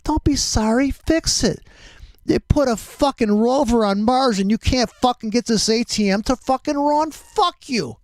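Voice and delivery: high-pitched, exasperated tirade; pissed off. Real, not acted. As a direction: rage at the bank, voice way up throughout. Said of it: "I'm getting pissed off".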